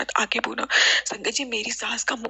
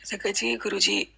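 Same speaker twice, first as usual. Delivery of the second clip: normal talking voice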